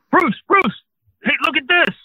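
high, excited shout across the lot; excited calling out. Retold or imitated. Crew member spotting Bruce calls out, high and excited. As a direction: call out to a friend, thrilled. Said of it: "I, I hear this".

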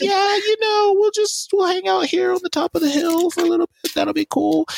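high, fast goofy voice for the brothers; mock carefree brothers. Brothers lounging on the hill, in a high goofy voice.

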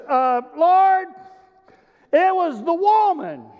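Adam passing the blame to the woman; excuse-making Adam. Acted. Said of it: "he leans over and he goes".

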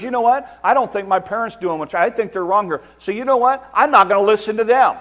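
loud, high mocking child turning on parents; child deciding to stop listening to parents; defiant kid. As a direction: toss it off as a rebel. Kid voiced defying parents.

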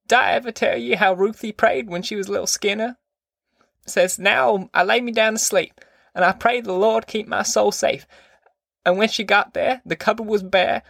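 put-on high-pitched granny reciting a child's prayer; rambling grandma. A narrator's reading. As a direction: tell it in a high, put-on old-woman voice.

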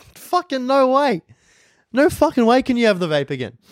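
retold indignation, pitch leaping way up; outraged refusal. Telling Dan he can't have the vape again, high outraged refusal.

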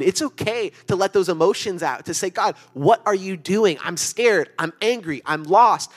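impassioned pulpit plea, voice lifted high; preaching at full force. Acted. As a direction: pour it out like a pleading prayer from the pulpit. Preaching builds to impassioned cries.

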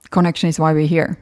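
usual speaking voice

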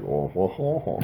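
mock French made of soft vowel noises; badly faked French sounds; fake French. Shown as a demonstration. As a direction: pretend to speak French with only honking vowels. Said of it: "that's not French".

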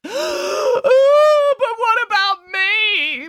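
high, wailing blubber; tearful high bear voice. A narrator's reading. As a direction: sob it out like a big weepy bear. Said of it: "blubbered Boris".

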